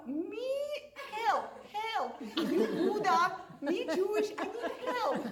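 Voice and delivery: high-pitched, halting cry for help; squeaky pidgin plea for help, hammed-up; mock broken-English plea.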